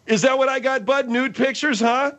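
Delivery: high-pitched, yelled challenge; indignant yelling. Acted. Host challenging Bud over nude pictures, indignant yelling. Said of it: "What are you yelling for?".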